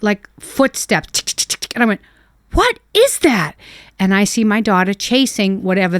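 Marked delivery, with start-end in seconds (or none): 2.5-3.5 high, slow startled question; alarmed at a noise in the dark. Retold or imitated. Mother startled by footsteps at night, high alarmed question.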